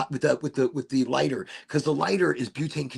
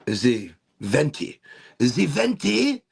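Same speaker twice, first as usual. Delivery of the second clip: slow, drawn-out mock French accent; 3 dB louder, slower than usual. Introducing the Venti in a slow mock French accent. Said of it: "I don't know why I'm French".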